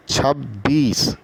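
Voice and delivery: teacher modelling छब्बीस for pupils; धीमा उच्चारण; slow demonstration. Shown as a demonstration. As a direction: say छब्बीस slowly and clearly. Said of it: "तो हम लिख सकते हैं".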